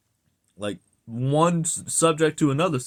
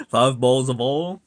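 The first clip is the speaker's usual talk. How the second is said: louder Southern slur, oil said as "ol"; Southern accent; 5 dB louder than usual. Shown as a demonstration. Southerner showing off their accent, Southern vowels. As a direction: run it together with a Southern accent. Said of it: "I do have an accent".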